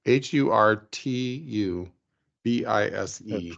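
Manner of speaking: slow, letter by letter; spelling it out. Real, not acted. Telling listeners the inventor's name, spelled out slowly.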